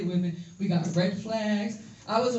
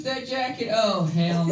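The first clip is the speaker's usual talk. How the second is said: ordinary delivery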